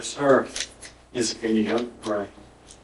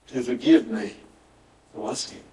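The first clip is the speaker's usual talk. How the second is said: whispered prayer; 3 semitones lower than usual. Retold or imitated. Quoted prayer of repentance.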